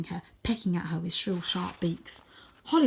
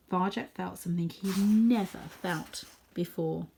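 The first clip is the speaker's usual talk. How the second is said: ordinary delivery, nothing marked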